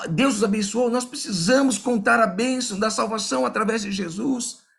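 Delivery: rally the room to go out and shine; preaching. Acted. Pastor urging the congregation to spread the light, preaching fervently.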